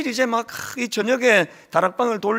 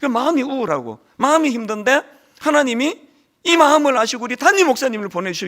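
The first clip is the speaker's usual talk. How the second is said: ordinary talk, nothing marked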